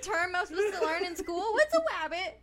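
high, loud laughing plea; baffled outburst. Real, not acted. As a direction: demand it, helpless with amusement. Amused panic at unknown word.

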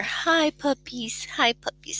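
high, slow sing-song to the dog; talking to a pet. Real, not acted. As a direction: coo softly at the puppy. Talking to her snorting dog.

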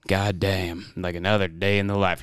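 mock-cool action hero one-liner; smooth line. Imagining a hero after a kill, smooth mock-cool line. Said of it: "he'd say some smooth line".

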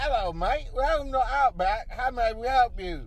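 loud, high mock Australian greeting; hammed-up Aussie waiter welcoming customers; Australian accent. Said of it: "I don't know why I sound British".

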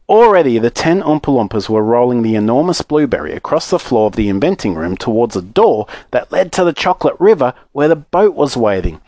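plain reading voice

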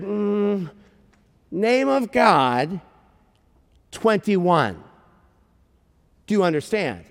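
slow, halting, effortful explanation; struggling to explain. Retold or imitated. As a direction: grope for words with long pauses, pitched a little up. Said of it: "he's, like, struggling".

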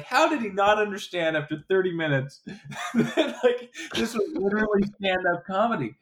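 high, laughing question of disbelief. Retold or imitated. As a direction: laugh through the words, amazed.

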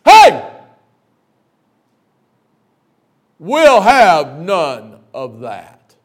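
very slow, loud booming command; big boss bellow. Retold or imitated. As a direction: boom down from the hilltop. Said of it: "Jolly Green Giant".

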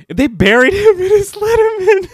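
high, loud, laughing outburst; laughing shout. Real, not acted. Host just realised the absurd burial, laughing shout. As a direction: exclaim it while cracking up.

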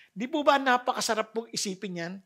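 normal talking voice

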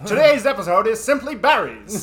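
put-on loud, high old-time announcer, laughing; 1940s radio announcer. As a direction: ham up the vintage sportscaster. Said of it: "nineteen forties, like, baseball announcer".

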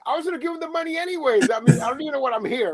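fast, high exasperated rush; exasperated rant. Retold or imitated. Lawyer baffled after losing, fast high rush. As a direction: blurt it out fast in disbelief. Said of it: "I'm like".

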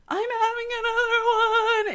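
in labor, crying out to the nurse; panicked cry. Retold or imitated. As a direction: cry out at the new contraction. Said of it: "And I was like".

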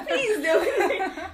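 high, loud laughing protest; laughing, begging them to stop; laughing plea. Real, not acted. Embarrassed guest laughing at host's memory, begging to stop. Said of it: "I still remember your voice on the first episode".

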